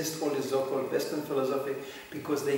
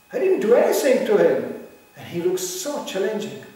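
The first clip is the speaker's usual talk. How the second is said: louder, higher indignant protest; mock indignant outburst; 5 semitones higher, 8 dB louder than usual. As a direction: burst out in mock offense. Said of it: "now he said".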